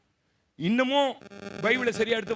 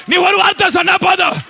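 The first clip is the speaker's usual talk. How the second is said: preaching at full force; 11 semitones higher, 16 dB louder than usual. Acted. Repeated prophetic line.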